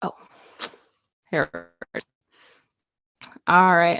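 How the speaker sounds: usual speaking voice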